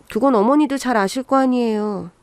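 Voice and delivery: plain reading voice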